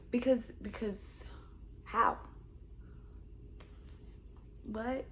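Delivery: unvoiced, baffled whisper; a hushed, stunned aside. Real, not acted. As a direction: breathe it out in disbelief.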